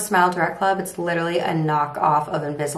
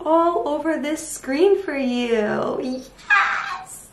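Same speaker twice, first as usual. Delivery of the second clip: excited; 10 semitones higher, much slower than usual. Acted. Excited cheer about receipts.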